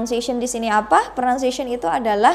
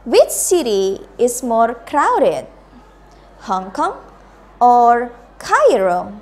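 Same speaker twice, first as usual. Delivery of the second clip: slow drill, "Hong Kong" rising, "Cairo" falling; choice-question intonation; 4 dB louder, much slower than usual. Shown as a demonstration. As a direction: lift "Hong Kong", hold a loud "or", let "Cairo" fall.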